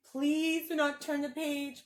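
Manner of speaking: lightly voiced furry blue monster, fretful and pleading; Grover voice. Teacher reading the book to kids as a worried Grover. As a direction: read it as a nervous puppet monster begging the kids.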